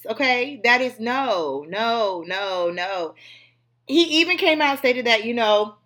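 normal talking voice

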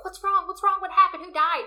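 high, loud mock-alarmed questions; imitated panic. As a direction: play a husband rushing in, panicked. Acting out husband running in alarmed.

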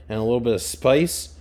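ordinary talk, nothing marked

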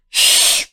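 sharp whispered shh; shushing. Shown as a demonstration. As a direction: hush the birds with a shh. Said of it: "tu se fizer assim". (um shh sussurrado)

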